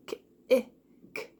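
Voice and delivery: "K, eh, k" as three separate sounds of kick; sounding out. Shown as a demonstration. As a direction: break kick into "K, eh, k", short I between. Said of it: "Does the word kick have the short I sound".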